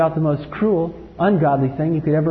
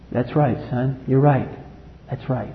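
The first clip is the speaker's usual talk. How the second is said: tender reply; 5 semitones lower, 6 dB quieter than usual. Acted. God answering Jesus gently.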